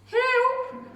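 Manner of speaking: high loud desperate cry; cry for help. Acted. Witch calling for help as the dragon chases her. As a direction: scream for help. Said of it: "cried the witch".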